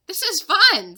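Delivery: loud delighted remark. Acted. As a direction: Annie loving the wild ride. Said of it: "said Annie".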